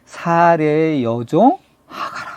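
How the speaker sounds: slow, drawn-out call by name; angel calling out. Acted. God's messenger calling Hagar, a slow summons. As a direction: stretch each word as a solemn summons. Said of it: "하나님의 사자가 뭐라 그래요?".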